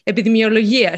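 epidemiologia said the Greek way; "epidemiologia" in Greek, not as English epidemiology; Greek pronunciation. Shown as a demonstration. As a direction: say "epidemiologia" as a Greek word, with Greek sounds. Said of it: "epidemiology is a Greek word".

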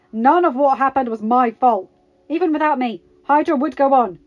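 loud, heated denial; spat out. A narrator's reading. Karpov denying blame for the Starks' deaths, spitting out. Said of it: "Karpov spat out".

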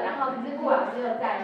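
ordinary delivery

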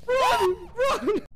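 high frightened yelling; panicked shout. Real, not acted. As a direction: yell in real panic. Fleeing ghost in game.

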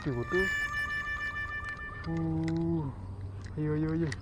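ordinary talk

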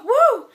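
high, loud "woo!". Real, not acted. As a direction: let out a big high whoop. Exhaling after rushing to finish.

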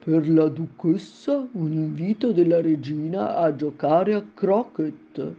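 slow, formal herald voice; solemn. A narrator's reading. Fish footman delivers the queen's invitation, slow solemn announcement. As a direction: announce it with ceremony. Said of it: "dicendo in tono solenne".

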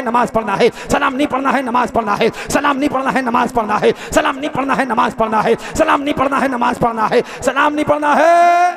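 loud, rhythmic, repetitive declamation; full-force preaching. Real, not acted. Preacher recounts the indoctrination, in loud rhythmic preaching. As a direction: drum the slogans home in a steady chant.

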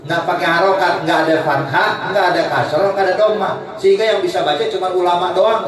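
normal talking voice

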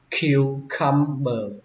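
cucumber slowly split as kyu-cum-ber; syllable by syllable. Shown as a demonstration. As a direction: sound out "cucumber" in slow, separate pieces. Teacher breaks word into syllables.

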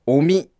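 おみ with the pitch high on お; accent on お. Shown as a demonstration. Reading a listener's note on the name, accent on お. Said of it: "おの方につくそうです".

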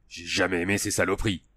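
growled. A narrator's reading. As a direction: grumble it under your breath like an old sergeant. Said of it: "grogna Hans". (grognement sourd et bougon)

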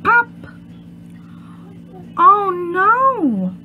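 ordinary talk, nothing marked

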